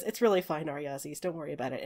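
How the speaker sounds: understated, worn-out reassurance; subdued brush-off. Acted. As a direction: wave it off quietly. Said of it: "really obvious that he's been crying".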